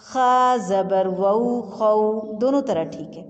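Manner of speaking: slow, drawn-out Qaida syllable chant; slow recitation drill. Shown as a demonstration. Teacher chanting a Noorani Qaida lesson slowly. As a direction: chant each syllable slowly for children to repeat.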